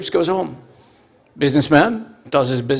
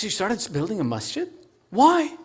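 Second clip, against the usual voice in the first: hushed disbelief, jumping high on why; softly incredulous question; puzzled wife; 4 semitones higher, 6 dB quieter than usual. Acted. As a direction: act out a baffled wife, soft then pitched up.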